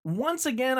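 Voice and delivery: ordinary delivery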